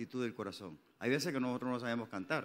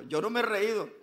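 Sarah denying; 6 semitones higher, 7 dB louder than usual. Retold or imitated. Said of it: "la otra dijo".

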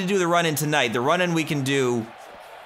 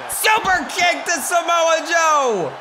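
higher, louder play-by-play shouting over the action; hype commentator; 4 dB louder, slower than usual. Pretend. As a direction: call the match like a ringside announcer. Mock wrestling commentary.

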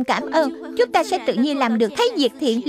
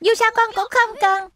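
put-on higher, resentful kid's complaint; boy telling mum she never cares, higher and sulky; sulky child; 6 semitones higher than usual. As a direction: throw the hurt back at mum. Sulky child refusing his mother.

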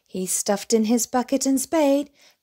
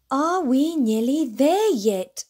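slow, whiny higher plea; complaining; 4 semitones higher than usual. A narrator's reading. Little bear complaining to Daddy on the long walk, slow and whiny. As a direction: whine it out, tired of walking. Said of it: "complained Little Bear".

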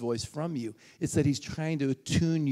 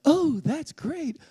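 high, very slow, forced cheer; sarcastically pretending to be delighted; mock cheerfulness; 12 semitones higher, 5 dB louder, much slower than usual. Mock cheer after big loss.

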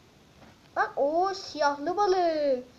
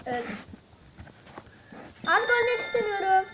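ordinary talk, nothing marked

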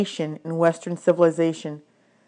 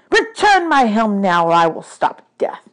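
threatening; 2 semitones higher, 6 dB louder than usual. Acted. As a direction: deliver a god's angry threat. God threatens the hero.

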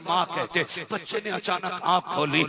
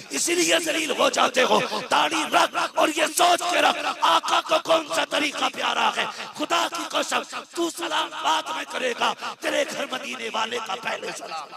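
high, loud preaching at the youth; fiery preaching; 9 semitones higher, 4 dB louder than usual. Real, not acted. Preacher scolds young men about beards, full-force preaching.

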